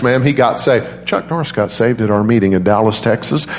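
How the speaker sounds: ordinary talk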